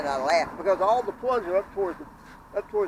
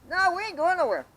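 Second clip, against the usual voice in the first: much higher, emphatic refusal; retold defiant refusal; 11 semitones higher, 4 dB louder than usual. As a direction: stand your ground in the retelling.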